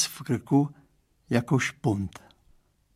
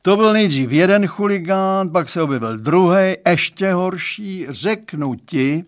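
higher, louder fatherly voice; dad confiding in the boy; Mr Stránský's voice; 5 semitones higher, 6 dB louder than usual. Acted. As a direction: play the girl's dad, earnest and chatty. Father tells of hooligans.

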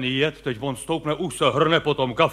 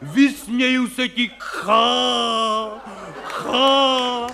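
mock heroic yell, higher and slow, ending in a laugh; hammed-up stage shout; 3 semitones higher, much slower than usual. Actor yelling at death on stage, mock heroic shout. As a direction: roar defiance at death like a ham actor. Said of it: "křičej tam, prostě řvou".

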